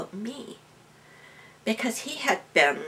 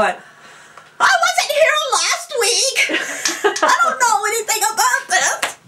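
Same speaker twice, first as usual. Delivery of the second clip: panicked protest; 9 dB louder than usual. Retold or imitated. Student picked as healing volunteer, protesting in mock panic. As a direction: squeal a comic, panicky excuse.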